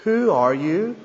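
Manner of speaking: normal talking voice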